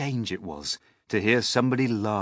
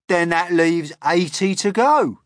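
high, loud bug voice; brash Centipede; 11 semitones higher, 10 dB louder than usual. A narrator's reading. Centipede tallies boots for James, brash. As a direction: reckon it up loudly.

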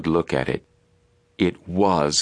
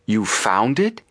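incredulous; 5 semitones higher, slower than usual. A narrator's reading. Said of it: "asked Frank incredulously".

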